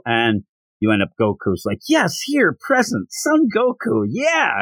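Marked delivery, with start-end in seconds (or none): 1.9-4.6 high-pitched eager roll-call answer; imitating an excited kid answering present. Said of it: "And he's all excited".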